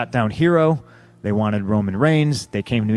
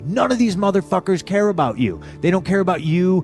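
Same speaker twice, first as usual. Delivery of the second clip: pitched up, driving and insistent; heated pep talk; 6 semitones higher than usual. Retold or imitated. Wrestler warning a newcomer that nobody backstage cares, heated. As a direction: hammer it home to a timid rookie.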